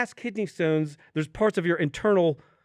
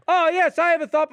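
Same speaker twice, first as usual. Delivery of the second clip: higher, louder, hammed-up eager voice; mock eager; 7 semitones higher, 4 dB louder than usual. Patient falling for the doctor's small talk, in a mock eager voice. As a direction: play the overeager patient taking the bait.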